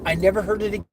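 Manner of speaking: plain reading voice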